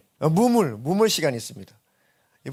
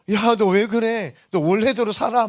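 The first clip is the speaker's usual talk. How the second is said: put-on loud, high, slow pleading as old friends; 8 semitones higher, 6 dB louder, slower than usual. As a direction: call him back to his old life, loudly. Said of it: "막 이러죠". (옛 친구들이 돌아오라고 크게 조르는 목소리)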